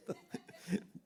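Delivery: breathy imitated laugh of the amused pastor; imitated fit of laughter. Preacher imitating pastor Max laughing at the scared man, breathy laugh. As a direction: mimic a helpless burst of laughter. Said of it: "estaba atacado de risa".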